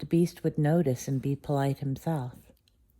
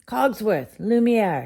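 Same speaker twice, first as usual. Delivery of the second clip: loud, higher bellowed names; angry master bellowing for his servants; 8 semitones higher, 8 dB louder, slower than usual. A narrator's reading. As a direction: bellow for the servants across the castle. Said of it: "he bellowed".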